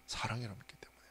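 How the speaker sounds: softly whispered ending; hushed confiding close. Real, not acted. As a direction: let it fall to a whisper. Whispered conclusion.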